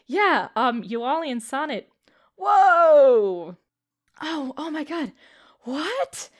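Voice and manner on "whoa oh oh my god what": startled wonder, voice up high; amazed. Acted. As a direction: gasp at the strange hallway. NPC amazed at portal.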